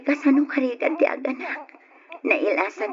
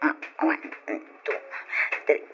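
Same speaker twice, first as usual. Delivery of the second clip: breathy counting; 6 semitones lower, 4 dB quieter than usual. Acted.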